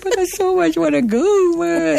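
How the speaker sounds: high, teary mock plea to the husband; retold crying plea. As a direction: whine it like a half-crying wife, pitched high. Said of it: "And I'm crying".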